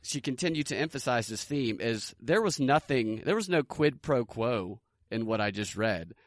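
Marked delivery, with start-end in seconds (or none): none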